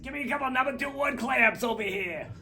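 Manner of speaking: high, loud gruff New York gaffer voice; old New York gaffer. Retold or imitated. Storyteller imitates gaffer Willie asking for clamps, gruff New York voice. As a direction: bark it like a crusty old gaffer. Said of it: "They always talk like this".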